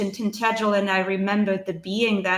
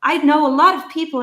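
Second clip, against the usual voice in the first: bright, high, louder burst of recognition; excited gentleman; 7 semitones higher, 7 dB louder than usual. Retold or imitated. Gentleman at the airport coffee stand, excitedly recognizing her partner's hometown. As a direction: jump up in pitch, delighted he knows the place. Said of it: "And he's like".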